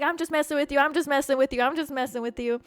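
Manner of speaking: high, repeated reassurance easing downward; placating backpedal. Retold or imitated. Man calming the offended storyteller, placating. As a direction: mimic someone talking his way out.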